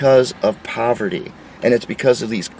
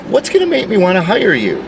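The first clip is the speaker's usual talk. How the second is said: ordinary delivery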